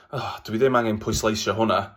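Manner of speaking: ordinary delivery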